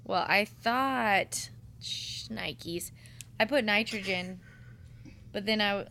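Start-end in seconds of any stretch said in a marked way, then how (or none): 1.8-2.9 whisper. Real, not acted. Muttered self-correction.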